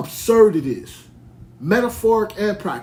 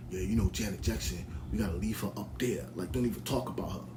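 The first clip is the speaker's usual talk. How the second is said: low laid-back imitation of the guy on the stream; imitating a rapper; 12 semitones lower, 12 dB quieter than usual. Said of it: "He's like".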